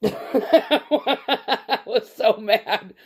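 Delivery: laughing through the words, high and loud; laughing hard at the memory. Real, not acted. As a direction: laugh it out in disbelief. Laughing while recalling anger.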